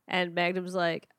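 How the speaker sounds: ordinary talk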